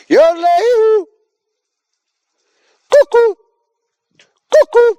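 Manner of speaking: high, loud echo call. Real, not acted. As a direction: yell it across the quarry like a cuckoo call. Shouting into quarry for echo.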